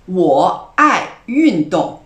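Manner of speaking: 我爱运动 said very slowly and loudly, each tone clear; slow model sentence. Shown as a demonstration. Teacher giving an example sentence, loud and slow. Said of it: "Prenons un exemple".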